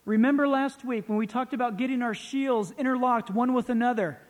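emphatic sermon delivery, loud and projected; forceful preaching. Real, not acted. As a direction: proclaim it to the whole congregation. Preaches from scripture at full voice.

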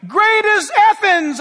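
slow, loud, high chant of the crowd; crowd shout. Retold or imitated. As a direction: cry it out like worshippers. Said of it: "they're all shouting".

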